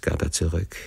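ordinary delivery, nothing marked

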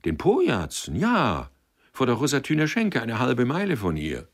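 bright-eyed lad calling out directions; eager boy. A narrator's reading. Boy eagerly answers Sender's question.

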